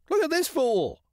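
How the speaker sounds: very high, squawky agreement; gleeful crow. Acted. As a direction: crow cawing along eagerly. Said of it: "The crow agreed wholeheartedly".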